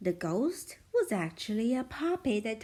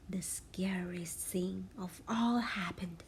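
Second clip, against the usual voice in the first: spooky whisper; 4 semitones lower, 5 dB quieter than usual. A narrator's reading.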